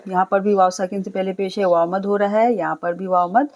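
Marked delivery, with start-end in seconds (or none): none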